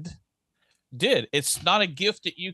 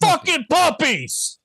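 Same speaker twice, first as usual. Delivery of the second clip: shouting; 12 semitones higher, 5 dB louder, much slower than usual. Pretend. Mock outburst.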